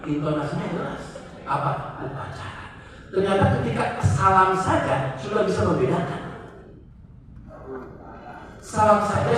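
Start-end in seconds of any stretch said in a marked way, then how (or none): none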